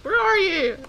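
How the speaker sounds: high, loud searching holler; calling out. Real, not acted. Gamer searching for the dragon, calling out loudly. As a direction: holler for someone out of sight.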